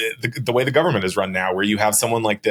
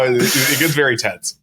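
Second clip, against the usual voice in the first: usual speaking voice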